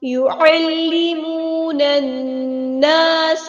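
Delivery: slow melodic tajweed chant; Quran recitation. Shown as a demonstration. Teacher recites the full verse for students, chanting. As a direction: intone the whole verse like a qari.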